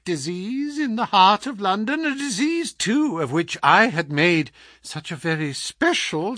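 high-pitched, savouring mock surprise; gloating visitor, sardonic. Visitor taunts the man he harmed, gloating. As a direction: relish his misfortune as you talk.

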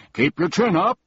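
loud cheerful call; shouting. A narrator's reading. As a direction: shout encouragement across. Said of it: "shouted the humbug".

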